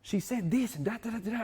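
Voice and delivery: high-pitched imitation of a thrilled man; acting out an elated phone call; excited. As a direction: gush out the news, voice way up. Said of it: "He's so excited".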